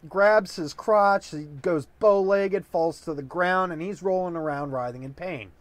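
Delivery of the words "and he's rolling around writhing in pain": usual speaking voice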